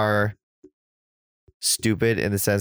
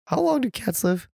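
voice cracking; 12 semitones higher than usual. Real, not acted. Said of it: "making fun of your voice crack".

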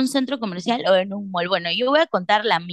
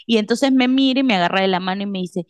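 ordinary talk

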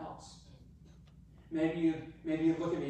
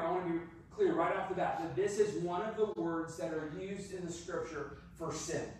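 usual speaking voice

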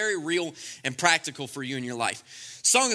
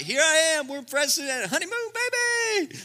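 high, breezy, pretending nothing happened; carefree husband acting innocent, higher voice; careless husband; 8 semitones higher than usual. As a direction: brush it off like nothing is wrong. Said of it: "Be like".

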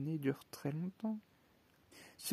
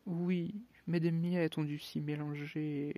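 plain reading voice

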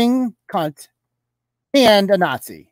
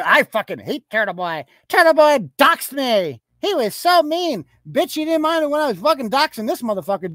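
high-pitched mock outrage of an aggrieved complainer; hammed-up high complaining voice; mocking whiny impersonation; 7 semitones higher than usual. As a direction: mimic their indignant complaint, voice pushed up high. Mimics complaining woman he mocks.

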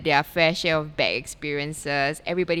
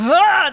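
loud, high straining grunt; deadlift grunt; 12 semitones higher, 10 dB louder than usual. Retold or imitated. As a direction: push out a lifting grunt.

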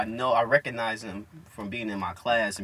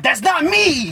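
high, loud outburst of protest; hammed-up high-pitched denial; mock yelled denial; 14 semitones higher, 9 dB louder, much slower than usual. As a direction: yell it like an accused schoolkid. Imitates classmate denying ear flicking.

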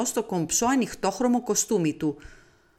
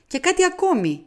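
mild raised objection; 12 semitones higher, 4 dB louder than usual. A narrator's reading. Hastings adds objection.

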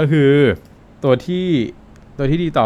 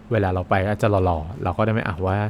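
ordinary talk, nothing marked

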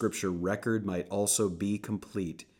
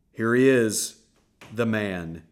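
loud announcement; 3 semitones higher, 9 dB louder than usual. Acted. Pilate presenting Jesus to the crowd, loud announcement. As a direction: proclaim it to the crowd. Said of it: "Pilate announced".

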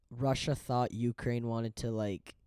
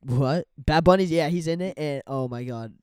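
excited; 5 semitones higher, 15 dB louder than usual. Real, not acted. Raving about the movie.

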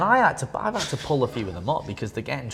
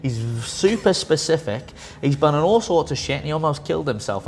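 emphatic lecture; 5 semitones higher, 5 dB louder than usual. Retold or imitated. Lecturing the young lifter.

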